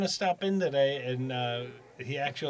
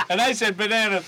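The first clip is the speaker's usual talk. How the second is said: imitating Vinny; 6 semitones higher, 3 dB louder than usual. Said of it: "he'll go".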